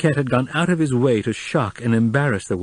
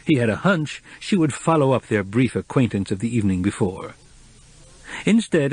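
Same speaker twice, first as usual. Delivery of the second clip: plain reading voice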